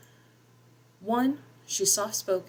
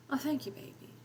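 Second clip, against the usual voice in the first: higher, softer drowsy slur; slurred; 8 semitones higher, 6 dB quieter than usual. A narrator's reading. Groggy mother thanking her daughter, drowsy slur. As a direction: slur it like she's just waking. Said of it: "Mama slurred".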